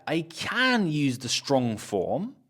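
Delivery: slow, CAN in its full strong form; stress on CAN. Shown as a demonstration. Teacher shows the strong form, stress on CAN. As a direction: lean hard on "can" with a full vowel. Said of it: "if I want to really stress that word".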